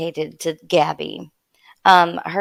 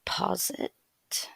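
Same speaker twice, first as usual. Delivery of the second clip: soft whispered aside; leaning in to whisper; 11 dB quieter than usual. Real, not acted. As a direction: whisper it under the breath. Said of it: "sorry if it sounds like I have to whisper".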